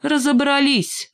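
high, drawn-out, decisive retort; cutting retort. A narrator's reading. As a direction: cut off the objection for good. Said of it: "отрезала железная кнопка". (отрезала, резко и высоко)

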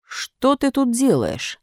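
slow, stern police demand; policemen's question. A narrator's reading. Two policemen questioning Pinocchio, mildly stern. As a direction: question him slowly and sternly. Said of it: "спросили они".

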